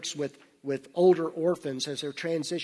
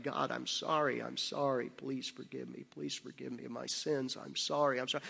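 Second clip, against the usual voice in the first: retold crying prayer; 3 semitones lower, 9 dB quieter than usual. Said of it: "breaks down in tears and starts crying".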